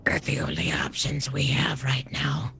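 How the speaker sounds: slow hushed whisper. Acted. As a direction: whisper it back. Whispered reply in character.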